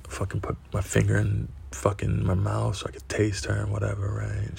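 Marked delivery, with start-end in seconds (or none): none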